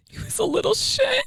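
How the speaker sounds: very high mock-tearful squeak; hammed-up weepy complaint; retold crying. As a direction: squeak it out through pretend tears. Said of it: "I cried all morning".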